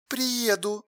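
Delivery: very soft, toneless reply; colourless. A narrator's reading. Alla agreeing to come over, colourless. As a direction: answer quietly with no colour. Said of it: "бесцветно ответила Алла".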